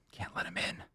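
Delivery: breathless whisper; whispered mantra. Real, not acted. Owner resisting the dog's eyes, whispered. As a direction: whisper it to yourself.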